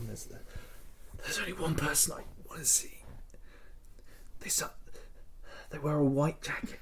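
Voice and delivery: very soft, halting whisper; faint words from a badly hurt patient; weak whisper. Injured Francis telling doctor who to fetch, weak whisper.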